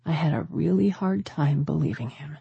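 ordinary delivery

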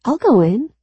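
high, loud shout. A narrator's reading. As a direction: shout it over the crowd. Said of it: "a voice shouts".

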